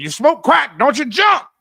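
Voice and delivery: loud, high mock threat yelled; Morgan Freeman. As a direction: bark it out like a threat. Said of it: "reminded me of the Morgan Freeman".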